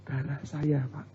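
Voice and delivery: soft, small imitated reply; meek admission. Beaten husband admits to the pastor it's his blood, imitated meekly. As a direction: drop the volume like a beaten husband owning up.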